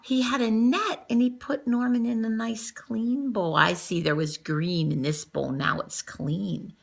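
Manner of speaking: ordinary delivery, nothing marked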